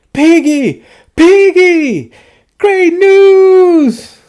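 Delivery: excited calling. Acted. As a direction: shout the good news across to a friend. Gerald calls to Piggie happily.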